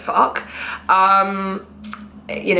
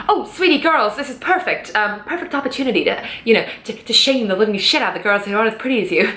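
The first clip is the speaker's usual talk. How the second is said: put-on terrible American accent. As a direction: put on a bad American accent, sweet-talking the girls. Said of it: "that was a terrible American accent".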